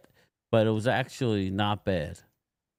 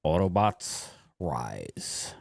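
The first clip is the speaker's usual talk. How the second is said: low, slow robot leader voice; slow, deep heroic robot declaration; 6 semitones lower, much slower than usual. Acted. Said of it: "you sound like the two freaking robots".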